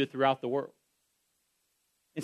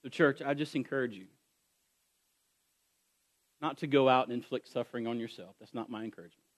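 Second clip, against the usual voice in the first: softer pastoral close; tender pastoral invitation; gentle appeal; 4 semitones lower, 7 dB quieter than usual. Acted. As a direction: ease down and plead gently. Soft closing appeal.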